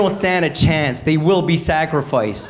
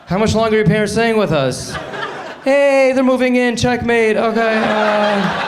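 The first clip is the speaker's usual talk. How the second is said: higher, gleeful, laughing through it; triumphant checkmate; 7 semitones higher than usual. Acted. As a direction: crow the winning move.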